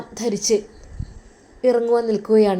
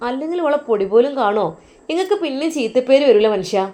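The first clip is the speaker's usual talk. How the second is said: wife rails loudly to her husband about his sisters; shrewish complaint; 2 semitones higher, 3 dB louder than usual. Acted.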